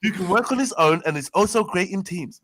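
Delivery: loud put-on posh job call; plummy interview voice; pretend posh voice. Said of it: "my pretend posh voice".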